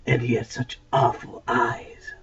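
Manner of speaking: slow, spooky whisper. Acted. Diggory to Polly about his uncle's eyes, whispered. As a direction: breathe it like a ghost story secret.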